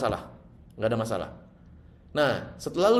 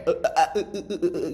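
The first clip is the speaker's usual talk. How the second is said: stuttering restart; 2 semitones higher than usual. Retold or imitated. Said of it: "akhirnya ngomongnya kayak".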